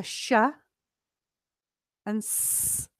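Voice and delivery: "sh" and "s" drawn out as separate sounds; isolated sounds. Shown as a demonstration. Teacher names sounds students find difficult, "sh" and "s" drawn out. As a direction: sound out "sh" and hold the hiss of "s".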